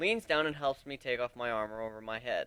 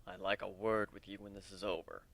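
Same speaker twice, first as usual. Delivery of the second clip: quiet aside; 7 semitones lower, 10 dB quieter than usual. Acted. Aoi quietly asks.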